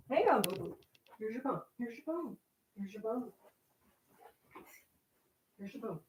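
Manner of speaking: soft doggy talk; soft pet talk. Real, not acted. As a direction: murmur to the dog as you hand the bone.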